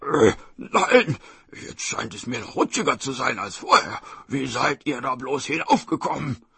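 tiger grumbling after slipping; exasperated tiger voice; frustrated tiger. Acted. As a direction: complain up to the children as a tiger.

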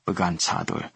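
plain reading voice

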